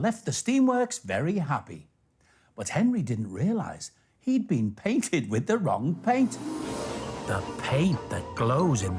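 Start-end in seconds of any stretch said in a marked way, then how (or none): none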